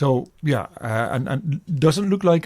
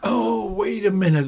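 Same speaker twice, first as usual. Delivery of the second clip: higher-pitched jolt of remembering; sudden recognition; 7 semitones higher than usual. Real, not acted. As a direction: exclaim as it suddenly comes back to you. Suddenly remembers a past guest.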